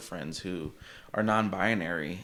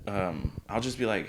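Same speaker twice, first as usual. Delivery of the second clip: ordinary talk, nothing marked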